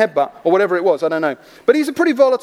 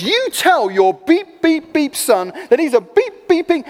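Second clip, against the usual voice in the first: swearing rage; 7 semitones higher than usual. Retold or imitated. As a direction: spit out a retold stream of curses. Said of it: "starts swearing at him".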